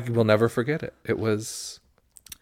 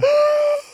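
excited gasp; 6 dB louder than usual. Real, not acted. Excited gasp at quiz answer.

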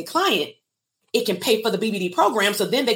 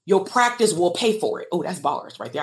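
ordinary talk, nothing marked

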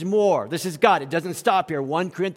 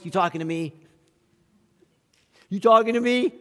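angry voice; 2 semitones higher, faster than usual. Retold or imitated. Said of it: "in an angry voice".

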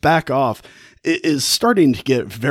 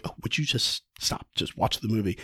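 annoyed whisper, softer; hushed scolding; 7 dB quieter than usual. Retold or imitated. Lavinia hushes Helen at the movies, annoyed whisper. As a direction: shush her friend during the film. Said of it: "And Lavinia's like".

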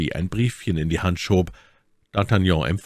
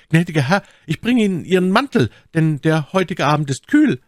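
high, loud servant calling to his master; raised, high servant's voice; 11 semitones higher, 5 dB louder than usual. A narrator's reading. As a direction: call across the dark, pitched right up. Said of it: "eine Stimme rief".

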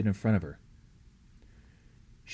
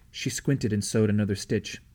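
ordinary delivery

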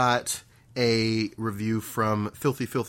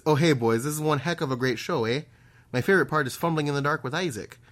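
put-on higher-pitched folksy Canadian-Irish accent; Fargo-meets-Irish accent; 4 semitones higher than usual. Podcast host reading a listener review, hammed-up Canadian accent. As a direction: lay on a hammed-up Canadian lilt. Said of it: "very, like, Fargo meets Luck of the Irish".

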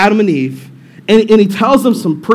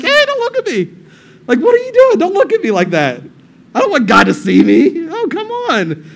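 high-pitched comic squeal of modesty; mock embarrassed protest; 7 semitones higher than usual. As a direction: protest in a squeaky voice, as if caught naked. Hiding naked couple voiced in retelling.

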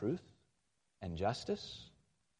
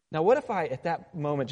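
normal talking voice

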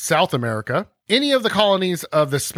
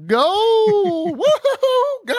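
shrill excited shout of gold; gold fever; 3 dB louder, much slower than usual. Pretend. Host hams up a gold-crazed conquistador, whooping. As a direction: squeal with greed for gold.

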